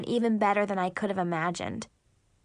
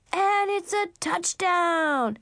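high, drawn-out sportscaster yell; screamed cheer; 7 semitones higher, slower than usual. A narrator's reading. Boy playing football with the dogs, screaming his touchdown. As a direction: call the touchdown like an announcer. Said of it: "he screamed".

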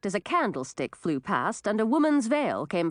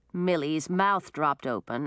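ordinary delivery

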